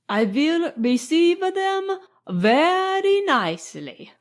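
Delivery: put-on high, slow foreign-accented English; careful foreign accent. A narrator's reading. Count reassuring his hostess about her friends, careful foreign accent. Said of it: "in careful English with an accent".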